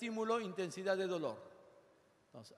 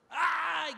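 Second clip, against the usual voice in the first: sharp cry of pain; 10 semitones higher, 8 dB louder than usual. Shown as a demonstration. Class demo of pain response.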